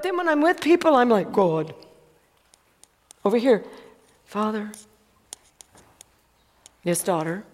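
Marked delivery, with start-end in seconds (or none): 1.3-1.7 pious. Retold or imitated. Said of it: "we step into a pious moment". 4.2-4.7 soft, low, reverent address. Retold or imitated. Believer softly praying to God, reverent and low. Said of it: "pious moment". 6.8-7.4 lower, soft fatherly reply; God's voice. Retold or imitated. God answering his daughter, lightly voiced, low and soft. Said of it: "He loves to throw that at me".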